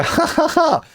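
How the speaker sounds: high-pitched fake cackle; mock psychopathic laugh. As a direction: imitate an unhinged, forced ha-ha-ha. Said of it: "if they have a psychopathic laugh".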